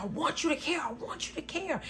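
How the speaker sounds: high-pitched pleading demand; mock desperate insistence. His insistent repeated plea re-enacted.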